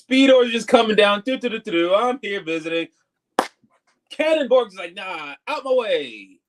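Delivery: high, mock-cartoon character lines; playful retelling in character voices; acted cartoon voices. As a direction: act out the scene in silly high voices.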